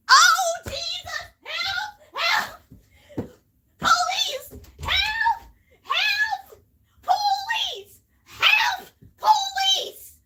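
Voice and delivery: high, loud desperate cries; screaming for help. Pretend. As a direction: yell for help like you're drowning.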